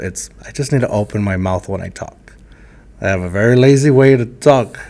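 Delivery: usual speaking voice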